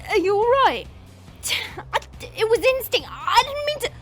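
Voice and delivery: panicked stammering concern; alarmed regret. Acted. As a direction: blurt it out, horrified at the harm done. Regretful after hurting him.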